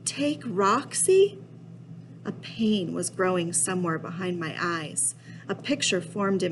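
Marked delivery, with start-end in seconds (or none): 0.0-1.4 slow, high-pitched disbelieving question; incredulous. Acted. Kid balks at taking little Roxy along, incredulous. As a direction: draw it out, rising sharply in dismay.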